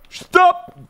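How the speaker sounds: high loud yelp of stop; jump-scare yell; startled shout. Real, not acted. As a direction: cry out in sudden alarm.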